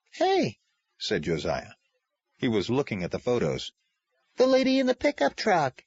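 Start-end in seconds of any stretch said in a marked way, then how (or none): 0.1-0.6 put-on high, bright little boy's voice; a kid suddenly noticing something; young boy. Young Josiah spots the photos, high child's voice. 4.4-5.8 put-on high little boy recognizing someone; young boy. Boy tells his father about the photos, high child's voice.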